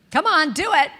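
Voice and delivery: loud, high call to the room; rallying the crowd. Real, not acted. Urging the retreat crowd to join in, loud and high. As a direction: pump up the audience.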